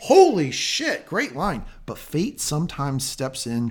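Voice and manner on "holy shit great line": excited outburst. Real, not acted. Raving about a quoted line.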